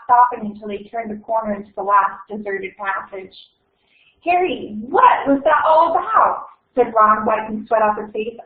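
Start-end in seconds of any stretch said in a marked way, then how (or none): 4.2-6.5 lightly voiced alarmed Ron. Ron to Harry after the chase, higher and alarmed. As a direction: call out breathless and alarmed.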